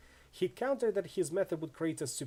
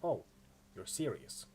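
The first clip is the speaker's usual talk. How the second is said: low, soft realization; sobered, lower and softer; amusement fading; 6 semitones lower, 4 dB quieter than usual. Acted. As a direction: drop it low and quiet, amusement gone. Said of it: "her smirk fades".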